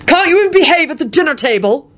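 high-pitched exasperated scolding; shouted scolding. A narrator's reading. Father to son at the dinner table, shouting crossly. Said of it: "Quentin shouted at him".

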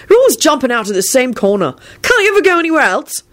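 high-pitched, exasperated complaint; disgusted. A narrator's reading. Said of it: "barked out disgustedly".

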